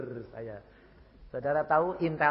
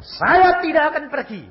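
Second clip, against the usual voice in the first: loud, much higher refusal; defiant shout; 11 dB louder than usual. Retold or imitated. Defiant reply to the intel. (menolak dengan berteriak)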